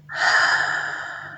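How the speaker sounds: soft satisfied exhale; breathy contented sigh; dreamy sigh. Real, not acted. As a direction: sigh like remembering bliss. Dreamy sigh after story.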